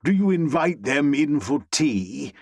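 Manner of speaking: mock British toff, poking fun; bad British accent. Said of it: "I feign a bad British accent".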